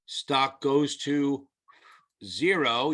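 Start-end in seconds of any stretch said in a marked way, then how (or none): none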